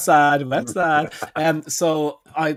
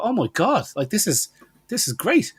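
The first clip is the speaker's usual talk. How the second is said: higher, thrilled exclaim; delighted; 7 semitones higher than usual. Retold or imitated. Retelling his delight at the dentist's prices, higher and thrilled. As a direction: gush over a great deal. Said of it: "And I thought".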